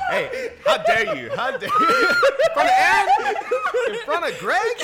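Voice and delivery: high laughing indignation; hammed-up offended squeal; mock outrage.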